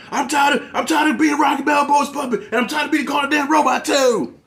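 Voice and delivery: mock the villain's whiny southern rant, shouting high; whiny southern accent. Reviewer mocking Tommy's bitchy whiny southern rant, high and loud. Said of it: "that southern accent".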